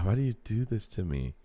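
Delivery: ordinary talk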